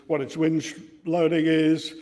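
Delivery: usual speaking voice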